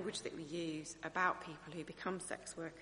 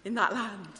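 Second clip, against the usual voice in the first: exaggerated West Country Bristol voice; broad Bristol accent; 2 semitones higher, 3 dB louder than usual.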